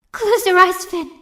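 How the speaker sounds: put-on high, loud urgent command to a child; urgent warning. Sister urges her little brother to shut his eyes, frightened. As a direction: shield him from the sight.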